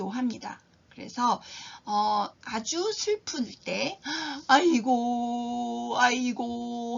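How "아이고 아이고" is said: very slow, drawn-out keening cry; mourning wail. Shown as a demonstration. Teacher demonstrating a funeral mourner's wail. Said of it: "하고 울-, 우는 거죠".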